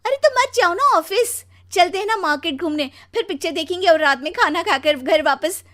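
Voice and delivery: high, loud, pushy pleading; insistent wife. Acted. पत्नी घूमने की ज़िद करती है. (ज़िद भरी ऊँची, तेज़ आवाज़)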